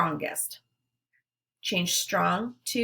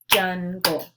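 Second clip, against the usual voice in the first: "Giggle" split into gig - gle, each beat punched; syllables clapped out; 5 dB louder than usual. Shown as a demonstration. Teacher models clapping syllables for the class, "Giggle" beat by beat. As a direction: clap out "Giggle" one syllable at a time. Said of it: "then clap out the syllables".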